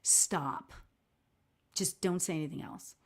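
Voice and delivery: a low, hushed warning from inside her head; lightly voiced stern inner voice telling her to stop. Inner voice warns her mid-apology, low and hushed. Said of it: "I heard in my head".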